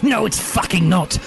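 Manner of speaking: high indignant screech. Acted. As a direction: screech it in protest. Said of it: "I screeched".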